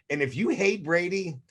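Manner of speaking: usual speaking voice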